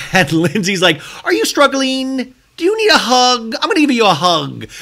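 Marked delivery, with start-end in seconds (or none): 1.2-4.7 condescending. Retold or imitated. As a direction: talk down with a fake-sweet voice. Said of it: "sounds so condescending".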